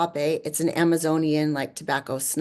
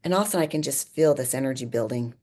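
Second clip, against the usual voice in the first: usual speaking voice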